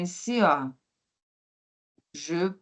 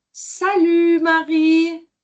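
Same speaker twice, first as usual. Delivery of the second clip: cheery greeting; 8 semitones higher, 5 dB louder than usual. Acted. Teacher voices café dialogue role.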